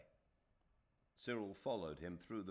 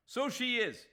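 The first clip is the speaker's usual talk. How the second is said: loud, high outburst; aufgebrachter Ausruf; 11 dB louder than usual. Real, not acted. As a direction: burst out with real fury. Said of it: "I was getting a little emotional about Aziz".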